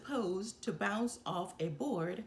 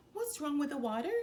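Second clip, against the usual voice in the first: wondering; 7 semitones higher, much faster than usual. A narrator's reading. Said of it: "wondered Timothy".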